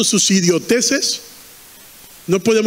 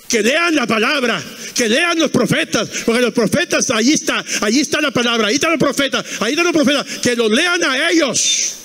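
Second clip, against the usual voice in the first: acted insistent answer; 4 semitones higher than usual.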